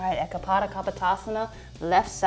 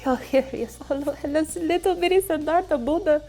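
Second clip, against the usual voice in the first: put-on high, cooing baby talk; baby voice greeting the newborn; 8 semitones higher than usual. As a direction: coo over the newborn.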